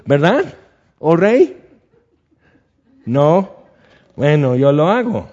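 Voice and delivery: cheeky sarcastic jab; sarcastic David. Retold or imitated. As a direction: throw the king's role back at him. David sarcastically challenging Saul.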